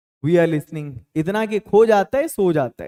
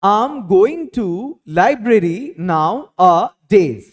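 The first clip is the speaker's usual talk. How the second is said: very slow, word by word, louder; slow dictation; 5 dB louder, much slower than usual. Shown as a demonstration. Teacher dictating an English sentence to Hindi students, slow and deliberate. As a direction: spell out the sentence slowly so students can follow. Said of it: "मैं आजकल library जा रहा हूँ".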